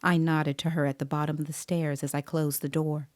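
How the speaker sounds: usual speaking voice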